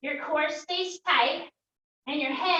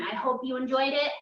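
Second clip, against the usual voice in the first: normal talking voice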